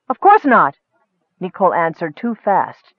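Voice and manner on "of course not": loud, high, indignant denial; too quick, angry. A narrator's reading. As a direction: snap back angrily, too fast. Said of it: "too angrily".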